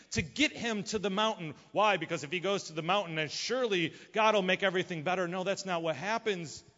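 ordinary talk

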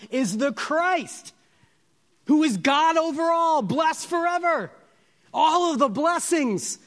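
high, loud, soaring sermon climax; full-force preaching. Acted. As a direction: preach it out to the back pew.

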